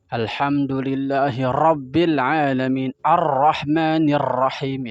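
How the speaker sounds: Ar-Rahmanir pelan dan keras, ra terlalu tebal; ra ketebalan. Retold or imitated. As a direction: tiru bacaan yang ketebalan. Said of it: "Itu ketebelan". (Ar-Rahmanir Rahim dibaca terlalu tebal)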